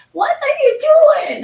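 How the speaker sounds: put-on high, loud furious kid shout; angry child yell. Mother imitates daughter shouting at her brother, high and loud. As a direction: yell at him like a girl whose castle got wrecked.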